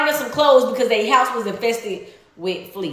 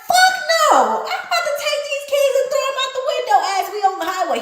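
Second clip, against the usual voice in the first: defiant refusal; 12 semitones higher, faster than usual. Retold or imitated. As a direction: toss back a cocky, high-pitched no. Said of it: "I'm like".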